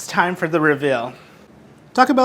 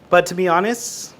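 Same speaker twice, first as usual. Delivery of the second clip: ordinary talk, nothing marked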